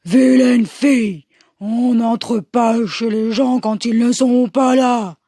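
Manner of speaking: Papa Bear's gruff telling-off; big scolding bear voice. Acted. Papa Bear scolding the fleeing girl, big gruff voice. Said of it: "la gronde de sa grosse voix".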